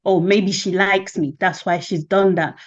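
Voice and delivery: ordinary delivery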